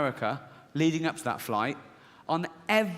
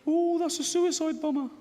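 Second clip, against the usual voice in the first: high, panicky little inner voice; irrational inner voice; 12 semitones higher than usual. Acted. Irrational side warns the rational one in a high squeak. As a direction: squeak it out as the paranoid side of the mind. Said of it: "this one goes".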